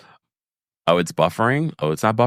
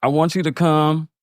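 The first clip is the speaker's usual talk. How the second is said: tight-lipped; 5 semitones higher, slower than usual. Retold or imitated. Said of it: "Tight-lipped teeth".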